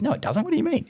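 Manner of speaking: high, mock-innocent denial of a caught dad. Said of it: "He goes".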